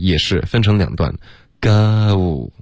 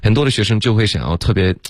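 ordinary talk

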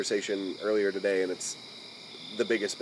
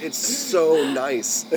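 ordinary talk, nothing marked

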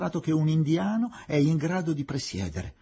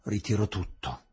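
low, matter-of-fact withdrawal; hard, prosaic; 4 semitones lower than usual. A narrator's reading. Witness withdrawing her charge, hard and prosaic. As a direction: state it hard and plain, pitched down. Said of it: "In tono duro e prosaico".

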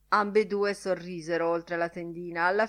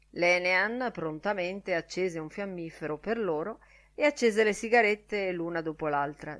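ordinary delivery, nothing marked

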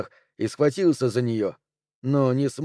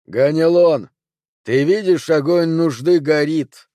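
loud, raised incantatory call; ringing call to someone entranced; commanding summons; 4 semitones higher, 6 dB louder than usual. A narrator's reading. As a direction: summon him from afar, high and forceful. Sorceress summons the sleeper.

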